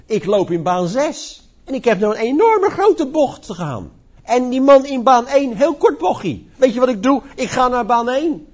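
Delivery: cheeky runner. Retold or imitated. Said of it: "je kan wel zeggen van".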